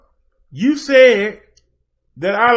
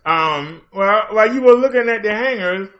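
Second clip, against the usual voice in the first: usual speaking voice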